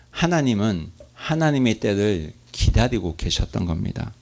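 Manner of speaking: normal talking voice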